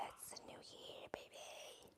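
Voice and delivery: barely audible whisper; hushed, voiceless murmur; whispered aside. Real, not acted. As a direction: whisper it under your breath. Hushed playful aside on stream.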